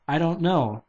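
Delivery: usual speaking voice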